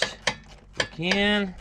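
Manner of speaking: usual speaking voice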